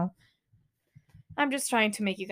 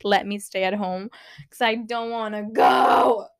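whining protest; 5 dB louder than usual. Real, not acted. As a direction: whine rising to a shout on "go". Dreads school, wants to stay home.

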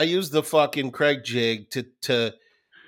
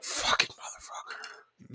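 whispering; 10 dB quieter, slower than usual. Retold or imitated. Muttered reaction to friend.

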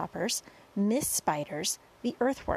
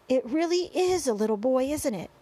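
higher, louder amazed exclamation; astonished cry; 5 semitones higher, 4 dB louder than usual. A narrator's reading. Fire chief going red in the face, crying out amazed. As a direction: exclaim in disbelief. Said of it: "cried the head of the fire department".

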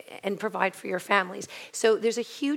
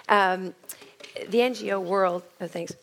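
normal talking voice

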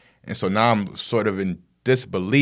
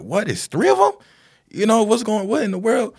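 very high-pitched disbelief; acted astonishment, voice leaping way up; retold shock; 13 semitones higher than usual. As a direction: squeak it out in stunned disbelief. Retelling his shock at more bodies.

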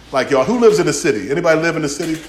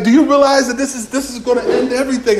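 frantic disbelief; 8 semitones higher, 4 dB louder than usual. Retold or imitated. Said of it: "I'm like".

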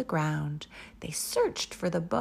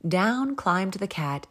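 ordinary delivery

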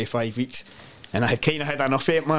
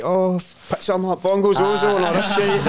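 high, louder teasing voice; teasing waiter; 7 semitones higher, 4 dB louder than usual. Retold or imitated. Staff teasing the visitors, high mock voice. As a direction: mimic the staff taking the piss.

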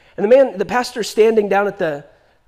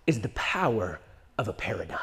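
low, soft, weighty; hushed emphasis; 12 semitones lower, 12 dB quieter than usual. Real, not acted. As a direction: drop to a hush to land the point. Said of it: "preacher's hushed emphasis".

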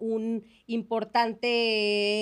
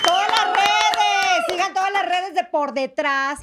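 high, loud, stretched-out plug; anuncio gritado; 6 semitones higher, 5 dB louder than usual. Real, not acted. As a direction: shout it over the applause. Host shouts the sign-off plug. (anuncio gritado y alargado)

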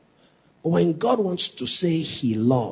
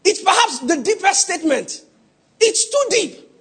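louder, higher, driving preacher's cry; preaching at full force; 11 semitones higher, 4 dB louder than usual. Acted. Preacher presses the congregation on God's love, shouting. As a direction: build the point to the congregation.

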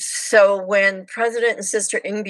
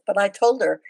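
ordinary delivery

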